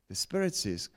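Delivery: normal talking voice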